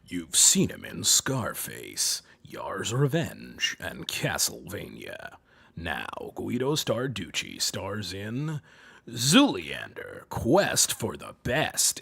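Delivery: very low, slow, sultry trailer voice; slow, rumbling sultry voice-over; sultry deep announcer. Shown as a demonstration. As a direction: drop deep and draw it out seductively. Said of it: "you gotta go more sultry with it".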